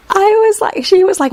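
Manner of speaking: high-pitched, laughing through the words. Real, not acted. Mom cracking up retelling her daughter's reaction, laughing. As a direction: barely get it out through giggles.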